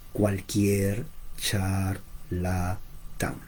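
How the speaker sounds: muy lento y bajo, sílaba por sílaba; despacio. Shown as a demonstration. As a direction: deletrea cada sílaba con calma y en voz baja. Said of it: "lo digo despacio". (dicho despacio, separando las sílabas)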